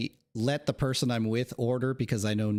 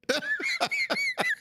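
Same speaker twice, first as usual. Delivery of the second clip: squeaky laugh. Real, not acted. Laughing at garbled phrase.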